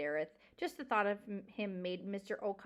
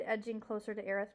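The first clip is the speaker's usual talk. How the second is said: ordinary delivery, nothing marked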